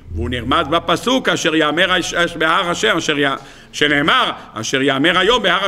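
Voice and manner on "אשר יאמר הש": ordinary talk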